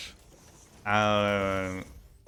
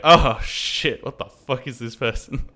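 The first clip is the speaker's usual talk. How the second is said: louder, shocked outburst from the stunned Americans; mock shock; 4 semitones higher, 7 dB louder, slower than usual. Stunned Americans at pottery class, loud mock shock. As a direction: blurt it out like you've been fooled. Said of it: "their faces apparently being like".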